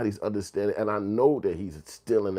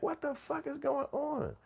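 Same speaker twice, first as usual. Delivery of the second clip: put-on baffled kid; 7 dB quieter than usual. Kid in front of the TV, high, baffled and soft. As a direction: squeak it out like a confused kid.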